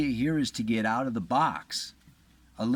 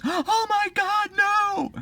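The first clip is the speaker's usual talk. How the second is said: much higher, loud horrified shriek; panicked cry; 5 dB louder, slower than usual. Retold or imitated. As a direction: scream it out, aghast. Real mother hears child will be cut.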